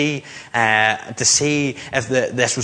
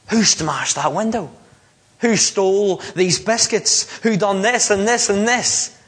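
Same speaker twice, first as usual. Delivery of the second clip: rising, mock-angry mum questioning; mum scolding; 6 semitones higher than usual. Mum interrogating her twin boys, in a mock-angry rising voice. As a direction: play the cross mum demanding answers.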